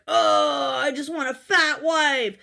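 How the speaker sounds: lightly voiced smug husband; a hint of a sneering husband; mocking husband voice.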